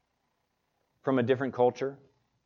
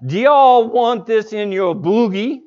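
loud, high imitated Southern drawl; hammed-up Southern drawl question; 6 semitones higher, 7 dB louder than usual. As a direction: mimic a Southern cashier, pitched up and louder. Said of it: "I cannot do the Southern drawl as she did it".

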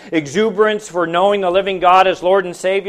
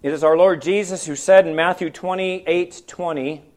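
ordinary delivery

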